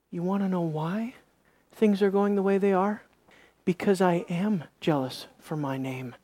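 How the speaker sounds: very soft, low and measured; hushed divine rebuke. Acted. God telling Joshua the reason, hushed and low. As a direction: drop to a quiet, low voice of authority.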